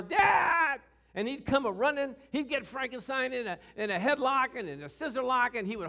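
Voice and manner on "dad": high, loud drawn-out call for help; acted kid's scream for his father; yell for Dad. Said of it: "all I'd have to do is yell".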